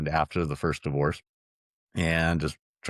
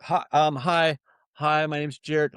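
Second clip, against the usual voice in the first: nervous caller; 10 semitones higher, slower than usual. Retold or imitated. Nervous caller introducing himself.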